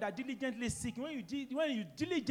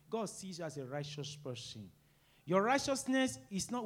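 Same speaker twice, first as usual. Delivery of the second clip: ordinary delivery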